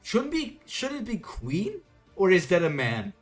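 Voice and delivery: ordinary talk, nothing marked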